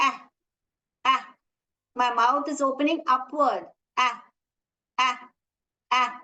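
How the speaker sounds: அ said short and open, mouth opening wide; short vowel demo. Shown as a demonstration. Teacher showing the vowel sound அ to the class. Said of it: "This one is".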